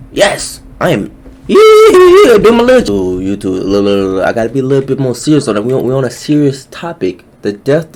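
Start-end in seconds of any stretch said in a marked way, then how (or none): 1.5-3.2 long, loud, high-pitched cheer; high celebratory squeal; gleeful whooping. Acted. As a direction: let out a drawn-out happy whoop. Celebrating before calming down to be serious.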